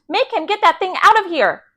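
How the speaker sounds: high, indignant order; alarmed, high-pitched command; booming. A narrator's reading. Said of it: "Mister Yarby boomed".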